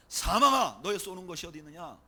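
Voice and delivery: loud cry on the first word, then easing. Retold or imitated. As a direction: call out defiantly to death, as a preacher quoting. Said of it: "이렇게 외쳐요".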